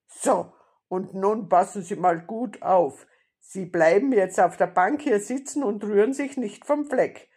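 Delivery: loud, emphatic bandit orders; commanding robber. A narrator's reading. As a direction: lay down the rules forcefully. Robber gives the grandmother orders.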